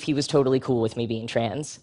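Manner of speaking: normal talking voice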